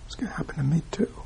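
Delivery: quick voiceless whisper; hushed, hurried whisper of realization. Retold or imitated. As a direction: murmur it fast like a secret dawning. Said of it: "But when I go".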